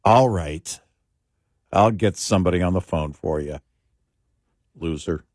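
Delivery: exasperated sigh, then reluctant compliance, dropping low; frustrated automated voice. Retold or imitated. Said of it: "I can almost hear her breathe".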